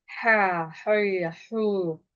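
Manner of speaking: "Ha, hı, hu" from the throat with heavy back vowels; throaty, over-thick "Ha, hı, hu"; thickened throaty h. Shown as a demonstration. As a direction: thicken each syllable of "Ha, hı, hu". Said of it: "kalınlaştırarak okuyor".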